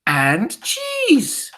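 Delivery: high, loud witch cry; ringing witch exclamation; Grand High Witch's shout. A narrator's reading. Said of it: "shouted the Grand High Witch".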